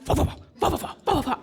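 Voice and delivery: whispered puffing cat snores; cat dream noises. Retold or imitated. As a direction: imitate a sleeping cat's little puffs. Imitating cat snoring.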